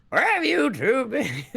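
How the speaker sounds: mock the elder voice, giggling; imitating old voice. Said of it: "sound about twenty".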